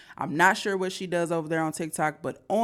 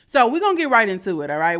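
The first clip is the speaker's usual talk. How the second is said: hyped up; 5 semitones higher, 8 dB louder, faster than usual. Real, not acted. Hyped launch into the video.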